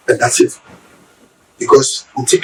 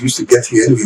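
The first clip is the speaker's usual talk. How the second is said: ordinary talk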